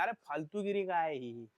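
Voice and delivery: normal talking voice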